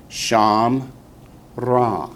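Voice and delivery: Sham, Ra, split by a clear pause; teacher's two-beat breakdown of Sham Ra; syllable by syllable. Shown as a demonstration. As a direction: sound out Sham and Ra separately. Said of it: "the first syllable's open".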